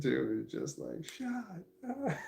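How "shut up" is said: squeaked imitation of a shout, kept quiet; animated mock yell. Quoting the adamant lawyer in the head, high animated mock yell. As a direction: mimic someone yelling, voice leaping high without volume. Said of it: "he's very animated".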